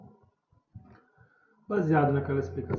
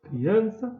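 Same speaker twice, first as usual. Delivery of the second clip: AN high and loud in criança; calling out; 7 semitones higher, 7 dB louder than usual. Shown as a demonstration. Teacher marking the tonic syllable, stressed AN in criança. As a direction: call "criança", lifting loudly on AN. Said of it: "o criança é o an".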